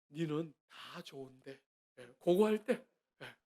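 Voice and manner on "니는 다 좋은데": sweet-talking intro. Retold or imitated. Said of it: "제가 좋은 말 할 때".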